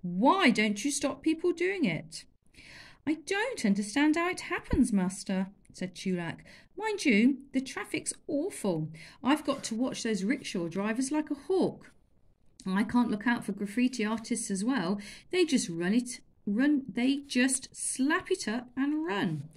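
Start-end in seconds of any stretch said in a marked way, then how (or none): 0.0-2.2 loud, higher exasperated demand. Acted. Master shouting at servant. 3.1-5.5 higher, lightly voiced boy feigning innocence; cheeky servant boy. 6.8-19.5 put-on higher, chatty boy's voice; cheeky boy excuses. Chulak making excuses.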